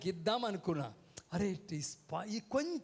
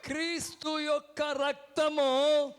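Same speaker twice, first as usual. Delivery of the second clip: higher, louder and slow, proclaiming it; 5 semitones higher, 6 dB louder, slower than usual. Shown as a demonstration. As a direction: call it out slowly and loudly for the congregation. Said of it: "మరొకసారి గట్టిగా చెప్పాలి".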